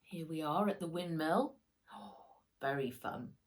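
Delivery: plain reading voice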